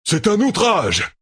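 high, drawn-out cry of outrage; outraged cry. A narrator's reading. Arrested general protests to his commander, crying out in outrage. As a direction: cry out slowly in indignation, pitch way up. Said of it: "cria Agelmar".

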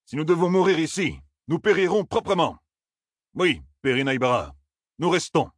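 high, emphatic declaration; indignant officer. Acted. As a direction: declare you will stand and die here. Bornald's angry reply to Perrin.

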